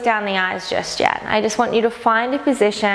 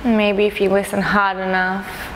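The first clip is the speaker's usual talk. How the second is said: calm, unhurried meditation guide, long pauses between phrases; guided meditation; 4 dB quieter than usual. Acted. Teacher inviting listeners to notice sounds around them, calm guided meditation. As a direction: gently lead them inward, leaving space after each phrase.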